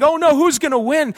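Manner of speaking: high hammed-up fretting; mock worry. Preacher mocking doubtful believers, high mock fretting. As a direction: wring your hands over the score. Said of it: "And you think".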